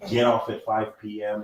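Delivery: ordinary talk, nothing marked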